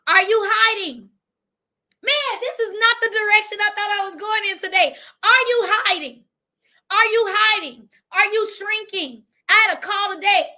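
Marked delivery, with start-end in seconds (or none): 0.1-7.9 higher and louder, hitting "hiding"; insistent preacher's challenge; pointed challenge. Real, not acted. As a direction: push each "Are you hiding?" at the listener. Repeated pointed challenge to the audience.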